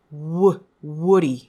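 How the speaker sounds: ウ as a loud English w, then ウディ with that same w; exaggerated w glide on ウ; strong English W. Shown as a demonstration. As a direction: lean hard on the w of ウディ. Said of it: "このダブリューの音がこうしっかりと".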